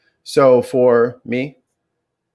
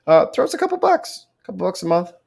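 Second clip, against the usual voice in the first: high squeaky voice; 7 semitones higher than usual. Pretend. Pitch for donations.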